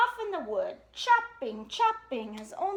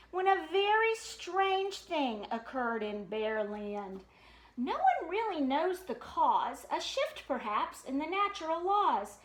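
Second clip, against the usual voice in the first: ordinary delivery, nothing marked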